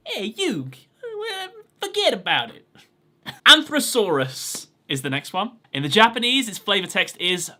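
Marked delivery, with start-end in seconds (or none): 0.1-2.7 squeaky, much higher mock Brooklyn "forget about it"; cartoonish Brooklyn accent, half laughing. Shown as a demonstration. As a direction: ham up a Brooklyn wiseguy, cracking up. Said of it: "a Brooklyn accent there".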